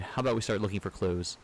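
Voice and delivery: usual speaking voice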